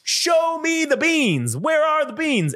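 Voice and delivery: mock lawyer demand. Speaker as bean lawyer demanding proof, high slow indignant voice. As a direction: play a hammy courtroom lawyer.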